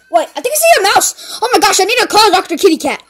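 high, loud, fast alarm; panicked exclaiming. Acted. Cook spotting a mouse, high and panicked.

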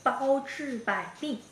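slow, hushed exaggerated mouthing; stage whisper. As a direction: shape each word, barely voiced. Mouthing phrase for lip reader.

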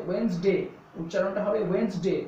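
ওয়েডনেসডে as two syllables "WENZ-day", silent d; correct pronunciation. Shown as a demonstration. Teacher saying the D stays silent, correct pronunciation. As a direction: drop the d in ওয়েডনেসডে, say wens-de.

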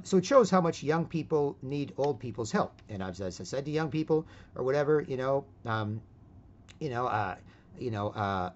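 usual speaking voice

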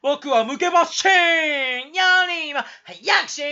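高く大声で、語尾を長く伸ばす; ふざけて一気に声を張り上げる; 芝居がかった絶叫. Acted. 突然芝居がかって叫ぶ. (大げさな甲高い叫び)

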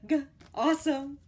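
usual speaking voice

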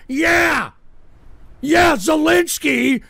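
high, drawn-out indignant yell; scornful shouting. Real, not acted. Host scornful over news item.

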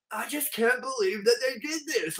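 lightly voiced upset moviegoer; mock dismay. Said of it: "he'll be like".

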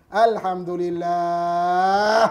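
loud, long-held, swelling last syllable; exaggerated Alhamdulillah through clear displeasure; different intonation, mock thanks. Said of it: "intonasi dia lain lah".

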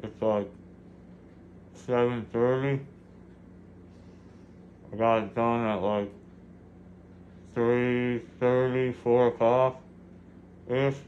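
speaking around gauze pads; muffled with gauze clenched; mouth full of gauze. Real, not acted.

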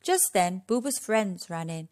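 ordinary delivery

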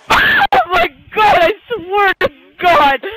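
high wail, slow and loud; drawn-out frustrated cry; anguished shout. Real, not acted. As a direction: wail it out in disbelief. Anguished at a near miss.

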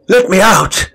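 high desperate shout; called out desperately. Acted. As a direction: yell to be let out, panicking. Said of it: "he called".

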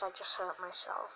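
quiet, fake sad murmur; mock sad whisper. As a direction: sigh it like a sulky child. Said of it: "So sad".

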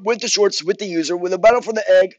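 high, loud goofy caller voice; short user voice. Pretend. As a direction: rattle off the order in a high put-on voice. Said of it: "Do the short user voice".

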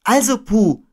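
put-on high, loud boy voice; Christopher Robin. A narrator's reading. Boy turning to Pooh, high and loud. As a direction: address the bear high and loud. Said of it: "sagte Christopher Robin".

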